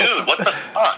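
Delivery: high, slow, laughing what-the-fuck; mock outrage through laughter; laughing disbelief. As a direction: crack up while asking in disbelief.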